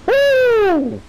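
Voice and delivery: high, loud cheer; excited whoop. Real, not acted. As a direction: whoop with joy. Cheering at game moment.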